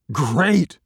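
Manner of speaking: loud, high bark of approval; barking dog. A narrator's reading. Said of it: "Dog barked".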